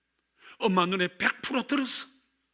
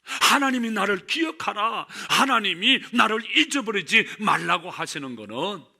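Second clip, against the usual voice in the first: ordinary delivery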